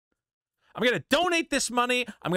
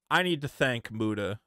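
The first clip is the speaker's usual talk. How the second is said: mock grateful statement; 10 semitones lower, 4 dB quieter than usual. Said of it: "This video should want".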